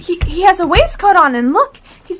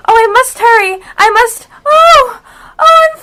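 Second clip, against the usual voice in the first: louder and higher into a scream; rising alarm; 5 semitones higher, 7 dB louder than usual. Acted. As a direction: panic as the ground gives way. Alice tumbling down rabbit hole.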